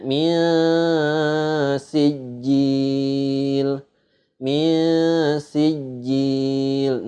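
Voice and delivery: "Min sijil" chanted very slowly, n held as a veiled hum; ikhfa recitation. Shown as a demonstration. Teacher demonstrates nun meeting sa, "Min sijil" with a long blurred n. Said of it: "nun mati ketemu sa".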